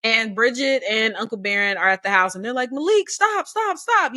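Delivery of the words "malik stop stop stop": high mock shout of stop; imitated yell. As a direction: call out to stop him, pitched way up. Relatives yelling from the porch.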